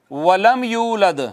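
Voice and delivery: slow recited वलम यूलद; slow recitation. Shown as a demonstration. Teacher reciting an example verse to the class. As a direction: draw out the verse calmly. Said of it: "मिसाल के तौर पर".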